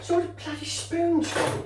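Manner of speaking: put-on sudden high-pitched Cockney accent; a playful high Cockney accent. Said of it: "Why am I talking in a Cockney accent?".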